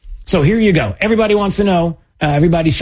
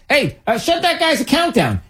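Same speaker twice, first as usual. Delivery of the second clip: mock official order; 11 semitones higher, 3 dB quieter than usual.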